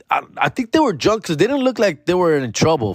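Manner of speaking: ordinary talk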